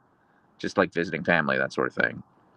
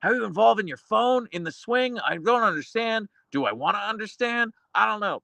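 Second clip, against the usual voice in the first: mock baffled, pitched way up; confused self-quote, voice jumped up high; 12 semitones higher than usual. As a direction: play it high and puzzled, like you can't picture it. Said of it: "I was like".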